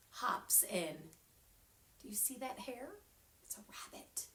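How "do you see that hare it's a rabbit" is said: soft aside whisper; hushed aside. Shown as a demonstration. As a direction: whisper it to the kids.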